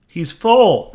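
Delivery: high thrilled exclamation; excited. Real, not acted. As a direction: burst out delighted. Excited at full generator.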